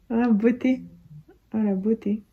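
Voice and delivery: high, loud tender coo; doting high coo. Real, not acted. Admiring her baby.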